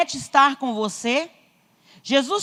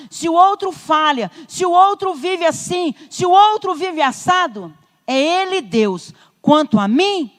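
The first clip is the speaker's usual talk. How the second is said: higher, driving sermon cadence; loud, insistent preacher in full flow; forceful preaching; 4 semitones higher, 5 dB louder than usual. Real, not acted. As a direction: hammer out each clause to the congregation.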